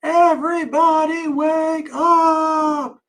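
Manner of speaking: long, high wake-up call held on every syllable; high drawn-out shout. Acted. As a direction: shout it slowly to wake the whole house. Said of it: "and howled".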